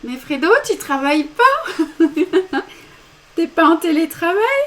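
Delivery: high, loud surprised exclamation breaking into laughter; delighted surprise. Real, not acted. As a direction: exclaim in amused surprise, teasing someone you know. Découvre Fredo pendant le direct. (surprise amusée, voix haute et forte)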